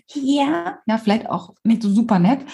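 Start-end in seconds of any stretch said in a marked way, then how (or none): 0.1-0.8 Rufen. Retold or imitated. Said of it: "Wenn ihr ruft".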